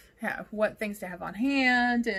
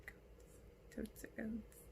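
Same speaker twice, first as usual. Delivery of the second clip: whispering; 18 dB quieter, much slower than usual. Real, not acted. Whispered joke.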